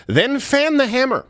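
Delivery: drawn-out, high-pitched comic call; high mock cry. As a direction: send it up high and slow, playfully. Mock battle cry after serious remark.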